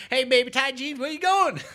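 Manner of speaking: high loud sleazy catcall. Retold or imitated. Campus guys catcalling the girl, loud and high. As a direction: holler it like a creep on campus. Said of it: "They're like".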